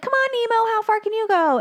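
put-on very high taunting kid voice; goading young classmates. Classmates daring Nemo to swim out, high-pitched goading. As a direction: egg him on in a squeaky voice.

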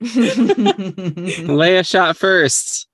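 very slow deliberate pronouncement; slow drawn-out. Acted. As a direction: stretch it out slowly.

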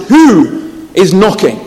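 loud, slow call through the church door; abbot shouting. A narrator's reading. Abbot calling out to the herald, loud and slow. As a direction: boom it out slowly from inside the church. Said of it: "the abbot shouted".

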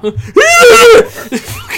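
high, loud squeal of laughter; shrieking laugh. Real, not acted. Cracking up at a stupid comment, shrieking laugh. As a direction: burst into a real high-pitched cackle.

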